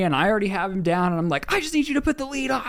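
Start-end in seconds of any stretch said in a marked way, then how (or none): 1.5-2.6 high, fast, strained mock scream; retold screaming. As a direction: imitate a frantic order without real volume. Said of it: "kind of screaming at him".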